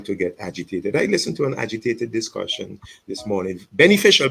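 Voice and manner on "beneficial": beneficial burst out much higher and louder; emphatic beneficial. Real, not acted. Pastor stressing that an agitated talk helped, loud. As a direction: punch beneficial hard. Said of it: "by the way, a beneficial discussion".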